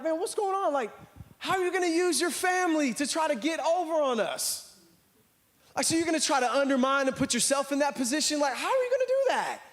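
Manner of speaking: high-pitched mock outrage; indignant. Disciples confronting two brothers who went behind their backs, indignant. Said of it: "they were indignant".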